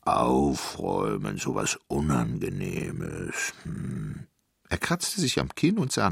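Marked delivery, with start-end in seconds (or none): 0.0-4.3 very low, slow giant voice; ponderous deep giant; grumbling giant. A narrator's reading. Giant grumbles about tidying up.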